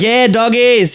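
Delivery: put-on a light Australian accent; Aussie catchphrase. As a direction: greet like a cheerful Aussie YouTuber. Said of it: "He always says this".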